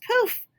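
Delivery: high, loud magic poof; squeaky sparkle of magic. Acted. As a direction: pop the poof like a spell. Magic sound effect.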